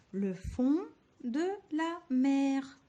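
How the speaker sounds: le fond de la mer, slowly and clearly; slow dictation. Shown as a demonstration. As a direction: stretch each syllable for the pupils. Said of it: "teacher dictates syllables slowly". (dictée lente et appuyée)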